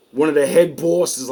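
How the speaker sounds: ordinary talk, nothing marked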